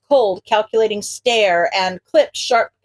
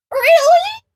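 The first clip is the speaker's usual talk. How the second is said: sudden high squeak of embarrassed disbelief; high, loud startled squeak; 4 dB louder than usual. Acted. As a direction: squeak it out, flustered. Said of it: "Izuku squeaks".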